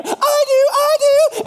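put-on very high, loud, slow child voices; eager kids. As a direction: squeal it like excited little kids.

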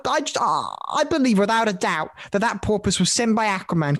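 ordinary talk

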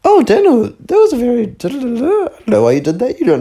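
put-on prim English accent. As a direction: mimic a prim English lady, starting very high. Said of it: "in this prim little English accent".

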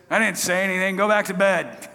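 put-on crotchety old priest's grumble; crotchety Eli. Old priest woken by young Samuel, crotchety. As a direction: send him off like a grumpy old man. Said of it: "he was an old crotchety man".

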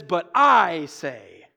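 long, loud stress on I; slow line, I held and stressed; emphatic I. Retold or imitated. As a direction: stretch out the word I with weight. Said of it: "The I is emphatic".

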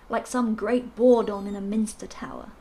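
ordinary delivery

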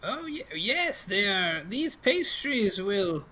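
high, slow, swooping crone; old pastry seller. Acted. Old lady promises customer dreams, high slow crone voice. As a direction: promise it in a drawn-out old woman's voice.

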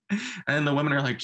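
normal talking voice

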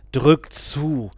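loud, strange-voiced order; a cult leader pushing him to strangle; guru's command. A narrator's reading. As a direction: bark the command in another voice. Guru urging him to kill.

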